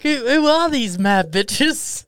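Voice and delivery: slow, high, loud incredulous voice; hammed-up shocked drawl; mock disbelief. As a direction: stretch it out like you can't believe it. Mocking disbelief at women's messages.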